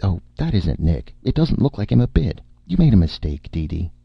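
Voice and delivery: hasty. A narrator's reading. Erica covering for Nick behind the curtains, hastily. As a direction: cover up in haste. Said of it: "said hastily".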